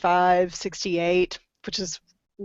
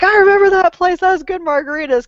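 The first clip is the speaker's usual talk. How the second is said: excited; 9 semitones higher, 5 dB louder than usual. Retold or imitated. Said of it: "I was like".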